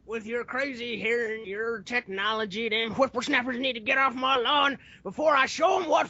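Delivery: hammed-up old-timer rant building to a shout; mock geezer scolding the youngsters; grumpy old man.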